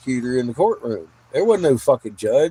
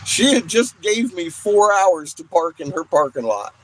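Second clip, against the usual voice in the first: laughing; 5 semitones higher than usual. Real, not acted. Still laughing after story.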